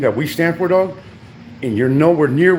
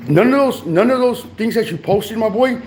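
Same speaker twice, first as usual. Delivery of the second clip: raised, heated pitch; 7 semitones higher than usual. Real, not acted. Ranting at rival YouTuber Bam Bam, heated and high. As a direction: jab each word up high and hot.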